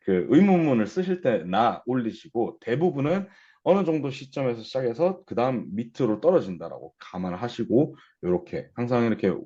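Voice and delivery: ordinary talk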